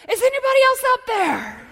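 high, loud holler; desperate calling out. Acted. Man on the cliff seeking other help, high loud holler. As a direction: yell into the distance for another rescuer. Dangling man calls for someone else.

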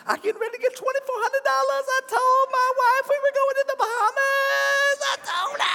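high, fast, giddy voice of a worker counting his pay; overjoyed worker bragging about the Bahamas, high and excited; giddy daydreaming. Retold or imitated. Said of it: "You're like".

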